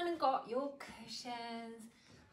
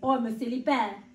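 mild giggle; 11 dB louder than usual. A narrator's reading. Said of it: "he giggled".

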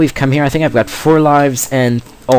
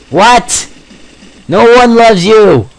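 high, loud outraged shout; indignant yell; 10 semitones higher, 6 dB louder, slower than usual. Real, not acted. Gamer yells at a monster, loud and outraged. Yelling at enemy.

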